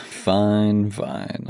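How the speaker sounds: ordinary talk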